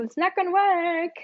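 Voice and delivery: high, loud "It's not gonna work" in the same cheerful tune; same high pitch. Shown as a demonstration. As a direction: say the bad news in the happy tune. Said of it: "But actually saying".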